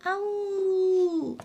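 high, drawn-out mock roar; 恐龙吼叫; dinosaur roar. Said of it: "小梁发怒了".

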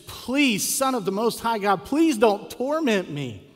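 loud, high plea, drawn out at the end; acted-out desperate plea, high and forceful; begging. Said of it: "And then he begs Jesus".

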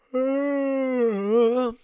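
big, loud, high yawn; long real yawn, voiced out loud. Real, not acted. Host breaks off mid-podcast for water, loud yawn. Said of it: "The yawns are back".

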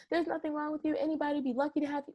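a hint of a fawning friend, hurried; mock gushing friend. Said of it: "some people can do that too".

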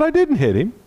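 high, indignant denial; indignant boy. Retold or imitated. Boy denying to his dad that he hit anyone, high indignant protest. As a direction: protest your innocence like a wronged kid. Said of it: "I said".

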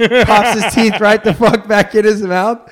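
slow, high, laughing through the words. Real, not acted. Host laughing at his own joke. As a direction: laugh out the punchline.

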